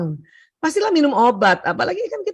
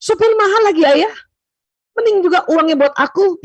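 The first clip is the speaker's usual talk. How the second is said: raised, higher, louder complaint; wife scolds husband, mock angry and raised; 5 semitones higher, 4 dB louder than usual. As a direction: snap back at the husband over the driver's cost. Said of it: "Istrinya marah".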